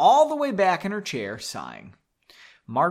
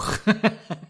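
laugh; 6 semitones higher, 3 dB louder than usual. Real, not acted. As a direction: burst of laughter. Laughs at own overdone take.

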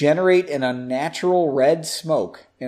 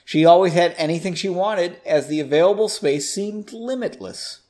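usual speaking voice

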